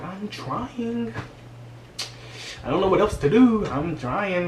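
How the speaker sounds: high, strained helplessness; pleading stepmom. Retold or imitated. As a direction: beg to be given a chance.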